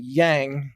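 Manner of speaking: "Yang" with the flat a of "hang"; English pronunciation. Shown as a demonstration. Host showing how English pronounces the name, anglicized "Yang". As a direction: say "Yang" to rhyme with "twang". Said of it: "in English, we pronounce it".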